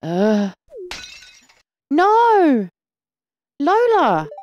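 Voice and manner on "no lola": loud, high cry of alarm; Lola!, a husband's panicked cry; alarmed shout. Acted. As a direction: shout her name as she falls. Freddy as Lola collapses.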